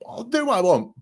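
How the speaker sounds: high-pitched mocking paraphrase; mock defiance. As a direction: mimic someone who does as he pleases. Voicing his hidden careless attitude.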